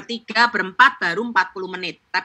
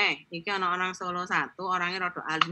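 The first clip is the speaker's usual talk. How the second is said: softer aside from a friend; hushed tip-off; 3 semitones lower, 10 dB quieter than usual. Retold or imitated. Friend quietly warning about the reserved Solo woman. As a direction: say it under your breath. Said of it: "dikasih tahu sama teman saya".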